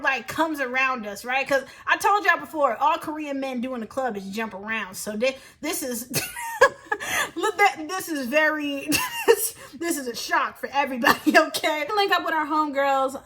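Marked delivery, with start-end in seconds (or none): 6.2-7.3 a high, breathless burst of laughter. Real, not acted. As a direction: crack up at the memory. Laughs at the crowd shock.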